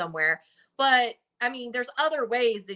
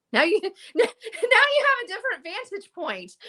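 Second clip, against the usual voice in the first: laughing; 11 semitones higher than usual. Real, not acted. Laughing at the new camera angle.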